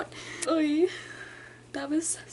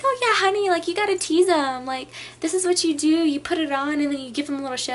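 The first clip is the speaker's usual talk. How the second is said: put-on higher and louder, gushing older lady; older customer giving advice; 5 semitones higher, 5 dB louder than usual. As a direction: play the chatty older lady at the register, high and loud. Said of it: "she was pretty much saying".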